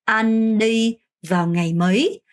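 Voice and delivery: very slow, rising "mấy"; teacher's slow question; slow model sentence. Shown as a demonstration. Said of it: "그럼 어떻게 해야 될까요?".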